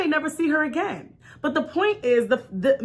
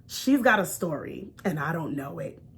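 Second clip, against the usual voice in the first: quiet inner voice; 8 semitones lower, 6 dB quieter than usual. Retold or imitated. Inner voice speaking.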